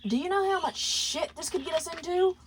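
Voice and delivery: high, scolding outburst. A narrator's reading. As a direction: tell him off sharply. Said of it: "Blitz then began to scold Moxie".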